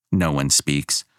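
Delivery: usual speaking voice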